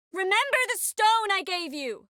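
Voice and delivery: high, urgent call across a distance. Acted. Calling out a parting reminder.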